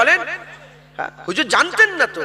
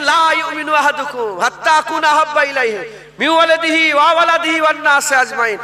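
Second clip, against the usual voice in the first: hadith recitation; 4 semitones higher, 3 dB louder than usual. Acted. Preacher recites Arabic hadith.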